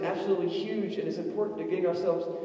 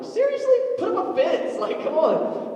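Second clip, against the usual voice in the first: very high, loud incredulous cry; dad mocking the alligator sign; mock outrage; 12 semitones higher, 6 dB louder than usual. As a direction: scoff in disbelief at the sign. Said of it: "I'm like".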